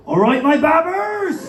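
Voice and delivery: slow, loud Bristolian announcer call; broad Bristol accent over the PA; Bristol tannoy announcement. Retold or imitated. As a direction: boom it out like a Bristol station tannoy. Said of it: "the best tannoy announcement".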